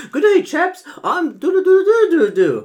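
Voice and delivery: high, loud mock British accent; hammed-up British chap trailing into silly filler syllables. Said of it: "he was all like".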